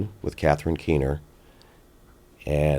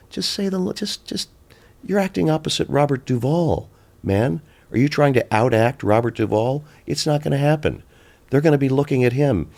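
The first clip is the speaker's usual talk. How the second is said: high, incredulous scolding director's voice, pitched up; exasperated director; 10 semitones higher than usual. Retold or imitated. Director pulling the actor aside, high exasperated scolding. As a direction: play the director who can't believe what he's seeing.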